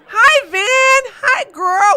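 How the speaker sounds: high, loud gushing hello; excited greeting. Real, not acted. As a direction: greet them like you're thrilled to see them. Excited greeting to chat viewers.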